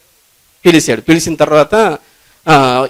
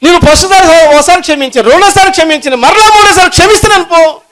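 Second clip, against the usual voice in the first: much higher, louder, fervent preaching; climactic preaching; 13 semitones higher, 5 dB louder than usual. Acted. Preacher voicing the exasperated king at full force. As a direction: drive it home at full force.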